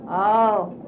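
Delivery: ordinary delivery, nothing marked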